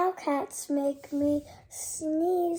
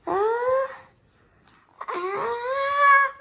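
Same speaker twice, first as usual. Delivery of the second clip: rising, higher ah before a sneeze; sneeze buildup; 8 semitones higher, 4 dB louder than usual. Acted. Pre-sneeze buildup in story.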